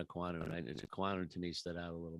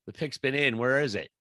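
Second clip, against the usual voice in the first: impatient calling out; 4 semitones higher, 10 dB louder than usual. Real, not acted. Impatient fans calling for the pick.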